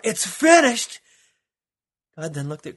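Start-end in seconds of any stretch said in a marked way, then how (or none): 0.0-1.0 high, loud scream of triumph; Jesus's final victorious cry. Acted. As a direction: scream it to the heavens. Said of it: "as he screamed out".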